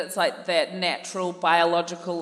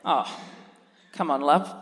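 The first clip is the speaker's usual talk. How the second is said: Dad voice; 2 semitones lower than usual. Pretend. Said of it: "That's my character work".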